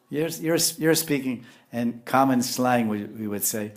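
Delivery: normal talking voice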